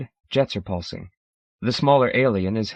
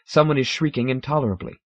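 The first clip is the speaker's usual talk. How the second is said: usual speaking voice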